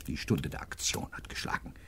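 hushed, breathy whisper. Acted. Detective to Hedge, whispering the hour has come. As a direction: whisper it like a secret.

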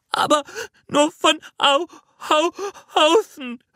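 put-on high child voice broken by sobs; sobbing. A narrator's reading. Jana protesting that it only looks alike, sobbing. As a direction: stammer through sobs, gasping between syllables. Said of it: "schluchzte Jana".

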